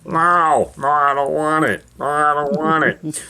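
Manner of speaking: a lightly voiced fussy refusal; mock Ray Romano saying no; Ray Romano impression. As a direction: whine no at the caterer. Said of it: "he's like".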